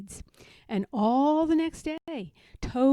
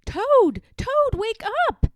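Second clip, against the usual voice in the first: calling out; 11 semitones higher, 10 dB louder than usual. A narrator's reading. Frog calling sleeping Toad.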